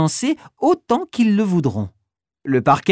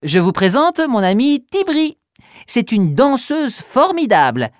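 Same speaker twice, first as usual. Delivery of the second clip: proud introduction; 6 semitones higher than usual. Acted. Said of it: "explique Noisette".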